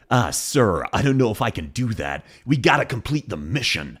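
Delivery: put-on American soldier trying an Australian accent; American doing Australian accent. Imitating soldiers who answer an order, American Aussie accent. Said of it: "American soldiers in Australia who have a stronger Australian accent".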